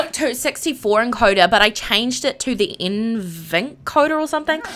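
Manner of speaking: ordinary delivery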